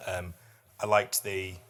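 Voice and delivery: ordinary talk, nothing marked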